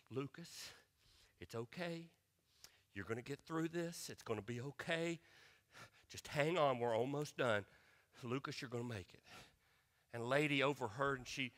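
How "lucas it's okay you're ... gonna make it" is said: put-on soft, hushed reassurance to a small child; calm him down gently; soothing dad. Dad leaning down to fussy toddler, soft and soothing.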